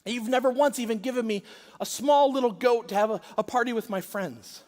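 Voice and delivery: put-on older son scolding his father outside the party, resentful and loud; resentful anger. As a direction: throw the grievance at your father. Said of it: "you could hear his resentment and his anger".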